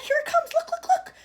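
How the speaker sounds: high, loud, thrilled call; excited cry. A narrator's reading. Wonka crying to the visitors to watch the screen, thrilled. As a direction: call them to look, bursting with excitement.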